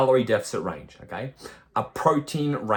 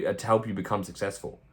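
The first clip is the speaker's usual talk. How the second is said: ordinary talk, nothing marked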